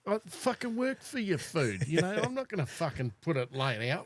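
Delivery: high-pitched grumble at a pet; mock indignant. Owner grumbles about feeding his cat, mock indignant.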